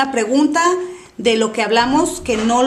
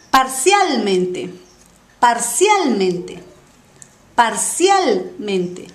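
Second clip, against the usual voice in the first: slow model pronunciation; slower than usual. Shown as a demonstration. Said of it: "model pronunciation for student".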